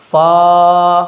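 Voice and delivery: "फा" held long on a level tone; pronunciation demo. Shown as a demonstration. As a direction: stretch the vowel of "फा" evenly. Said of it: "ये कैसे पढ़ा जाएगा". ("फा" को खींचकर पढ़ें)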